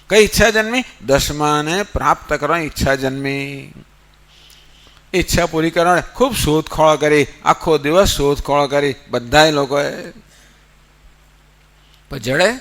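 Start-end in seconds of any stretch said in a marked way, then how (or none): none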